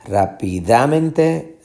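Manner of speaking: slow rápidamente with DA stressed. Shown as a demonstration. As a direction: drag rápidamente out, leaning on DA. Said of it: "la fuerza de pronunciación no está aquí". (rápidamente lento, con acento en DA)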